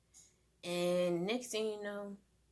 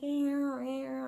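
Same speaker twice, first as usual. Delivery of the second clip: cat imitation; 7 semitones higher than usual. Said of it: "she be like".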